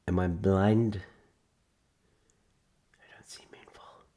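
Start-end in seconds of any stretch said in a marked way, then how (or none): 2.9-4.0 unvoiced whisper to self; soft muttered whisper. Real, not acted. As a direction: whisper it under your breath. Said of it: "whispered to self".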